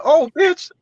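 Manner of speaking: high, loud hammed-up outrage; fake mad. Man on the phone faking fury at the girl, high hammed-up yell. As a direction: overact the grief and anger. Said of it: "I'm fake mad".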